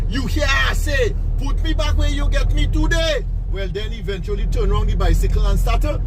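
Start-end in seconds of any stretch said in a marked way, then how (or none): 0.1-3.2 put-on baby repeating his demand. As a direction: press the demand harder the second time. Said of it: "The baby repeat himself by saying".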